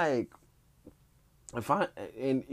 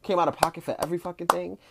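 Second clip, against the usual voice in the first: higher, heated imitation of an outraged contestant; hammed-up indignant rant, pitched up; retold chop rage; 5 semitones higher than usual. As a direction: rant like you paid for all of it.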